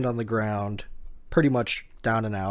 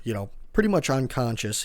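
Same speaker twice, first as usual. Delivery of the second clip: ordinary delivery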